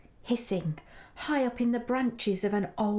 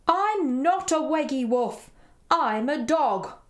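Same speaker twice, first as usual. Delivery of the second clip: louder, higher storybook dog voice; woofing dog; 5 semitones higher, 5 dB louder than usual. A narrator's reading. Dog corrects Edward's name for it, woofing loudly. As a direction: protest like an affronted dog. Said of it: "woofed the dog".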